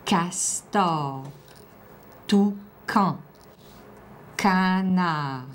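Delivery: slow, separated syllables; careful word dictation. Shown as a demonstration. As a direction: split each word so the sounds stand out. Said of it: "Ah, écoute bien".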